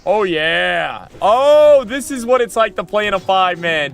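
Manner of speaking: loud, high excited yelling; hyped shout. Real, not acted. Streamer yelling with joy at his five-man group. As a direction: shout with glee at the full group.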